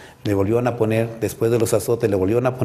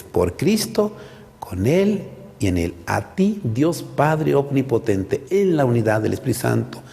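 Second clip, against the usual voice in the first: slow, solemn recitation with rising swells; liturgical doxology; 2 semitones higher, slower than usual. Shown as a demonstration. Priest offering to the Father por Cristo, slow solemn doxology. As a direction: draw out each phrase like a priest at the altar.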